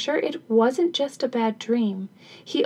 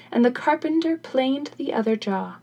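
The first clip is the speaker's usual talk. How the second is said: usual speaking voice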